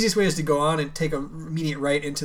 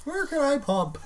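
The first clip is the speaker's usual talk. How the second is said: mocking high voice; 10 semitones higher than usual. Mock email question to venue.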